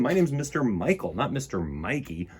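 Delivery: usual speaking voice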